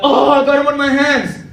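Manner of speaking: high, loud mock cry of dismay; imitated yell. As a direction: yell out like a coworker with a mishap. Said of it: "and then he yells out".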